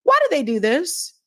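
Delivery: normal talking voice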